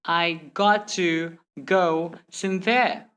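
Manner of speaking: slow, "got" and "to" separated; word-by-word. Shown as a demonstration. Teacher showing the slow way to avoid, word-by-word. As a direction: say every word by itself. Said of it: "Instead of saying".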